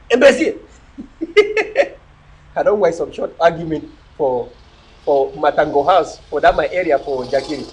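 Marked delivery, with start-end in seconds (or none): 0.1-2.5 laughing insult. Real, not acted. Mock insult then laugh.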